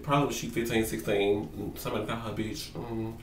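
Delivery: ordinary talk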